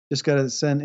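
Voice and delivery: usual speaking voice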